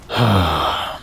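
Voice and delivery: long bored exhale; tired demon sigh; weary sigh. Acted. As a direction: sigh like this is beneath you.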